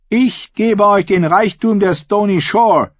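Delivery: high-pitched, forceful oratory to a hall; defiant female claimant. Acted. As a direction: challenge the crowd like a contender for the crown. Asha presents mock treasure.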